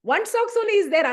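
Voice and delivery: high, loud frantic hurrying; mock fury, scolding the late child; fierce panicky mother. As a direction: rush the child like a raging goddess. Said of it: "I'm here like a Rudra Rupi".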